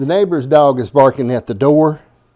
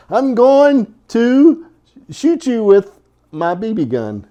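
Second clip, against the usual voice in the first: mock threat to dog; 7 semitones higher, 3 dB louder, slower than usual. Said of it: "She barks".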